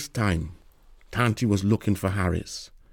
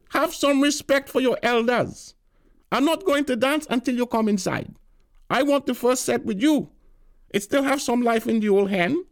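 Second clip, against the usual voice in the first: put-on bossy old aunt; 14 semitones higher than usual. A narrator's reading. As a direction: lay down the law as the elder. Tanti lecturing Harris.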